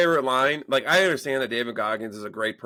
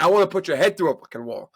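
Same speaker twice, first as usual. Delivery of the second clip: higher-pitched mock threat, retold; exasperated threat; 6 semitones higher, 4 dB louder than usual. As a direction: vent like a fed-up dad. Fed-up dad's retold threat.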